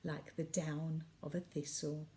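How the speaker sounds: lilting storybook reading of a Christmas poem; poem recital. A narrator's reading. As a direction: read it like a bedtime Christmas poem for the family. Reads Christmas poem to listeners.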